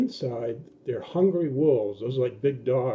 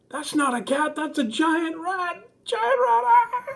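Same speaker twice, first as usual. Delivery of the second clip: much higher, louder frightened shriek building to a scream; a sister shrieking at a giant rat; panicked scream; 5 dB louder, slower than usual. Retold or imitated.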